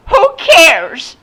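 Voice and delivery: high, loud, screechy brush-off; scornful retort. A narrator's reading. Woman to the man missing his umbrella, scornful and shrill. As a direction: fling it back loudly and shrilly. Woman snaps back in quarrel.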